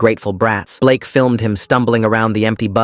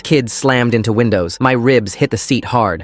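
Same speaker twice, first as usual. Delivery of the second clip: ordinary delivery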